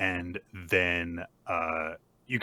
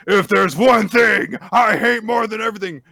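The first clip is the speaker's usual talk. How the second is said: loud, high bellow; hammed-up villain outrage; acted furious rant; 13 semitones higher, 12 dB louder than usual. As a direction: shout it as an enraged bully. Acted furious speech by Kaneda.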